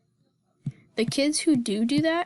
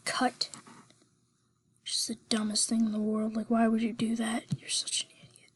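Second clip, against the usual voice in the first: whispering; 7 dB quieter than usual. Real, not acted. Whispered scolding aside.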